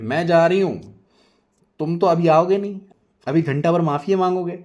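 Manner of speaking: loud high parting jab; wife huffing at husband as she leaves; sulky huff. Acted. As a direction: storm off with a taunt. Nazia storming off at Rehan.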